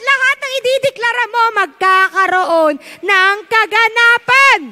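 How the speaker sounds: fiery sermon climax; full force preaching. Acted. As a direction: preach it out to the whole hall.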